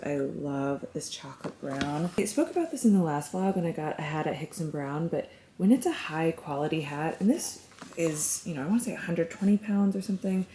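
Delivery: soft, near-whispered delight; hushed. Real, not acted. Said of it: "I'm whispering Sophie's asleep".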